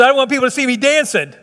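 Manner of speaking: lightly mock Trump while laughing; quick, laughing, lightly voiced Trump; Donald Trump impression. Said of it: "[name]'s back in there doing Donald Trump".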